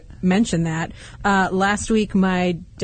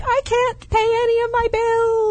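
much higher mock panic cry. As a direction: squeal it like a playful panic. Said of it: "there were a few days where I'm like".

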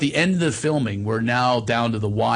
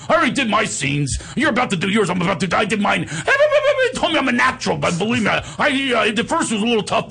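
high, stammering, self-important braggart; Steiny; 7 semitones higher than usual. Retold or imitated. Amateur actor chats up a movie star, boastful rambling impression. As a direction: ramble excitedly in a raised comic voice. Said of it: "He goes".

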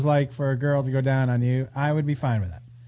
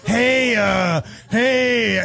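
imitating creepy guy voice; 4 semitones higher, much slower than usual. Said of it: "it sounds like somebody from The Simpsons in the bar".

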